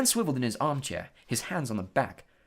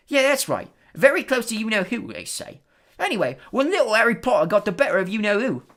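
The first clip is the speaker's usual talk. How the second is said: higher, louder Cockney storyteller lad; gossipy bus conductor; 9 semitones higher, 8 dB louder than usual. Acted. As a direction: spin the tale with relish.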